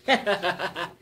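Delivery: a light flirtatious giggle; coy laugh. Acted. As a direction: giggle teasingly at him. Woman laughs on the ride.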